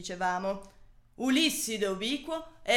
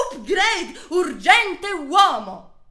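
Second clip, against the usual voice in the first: slow shout; 4 semitones higher, 6 dB louder, much slower than usual. Acted.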